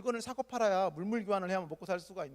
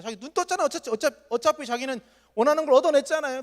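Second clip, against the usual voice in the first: higher and louder, building up; excited emphasis; 8 semitones higher, 9 dB louder than usual. Real, not acted. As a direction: push it out with rising excitement. Preacher emphasizing eagerly. (높고 크게 흥분해서 강조)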